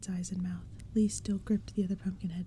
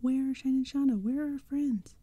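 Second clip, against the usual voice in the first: higher, louder frightened demand; desperate call; 6 semitones higher, 6 dB louder than usual. Acted. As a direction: yell for your missing friends. Kid desperately calling out.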